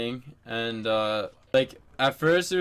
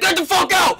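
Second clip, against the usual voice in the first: full-force, high-pitched re-enacted scream; retold scream; 10 dB louder than usual. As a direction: scream it as you did in the argument. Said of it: "I was fucking screaming".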